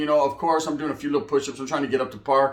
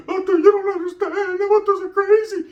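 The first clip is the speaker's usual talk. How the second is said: much higher, giggly gushing voice; mock fanboy squeal with a laugh; 4 dB louder than usual. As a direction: gush like a starstruck fan. Mocking gushing over enemy.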